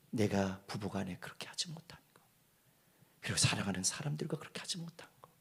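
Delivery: soft, low, half-whispered retelling; hushed storytelling. Acted. Pastor retelling a dying member's regrets, soft and hushed. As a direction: draw them in with a hushed voice.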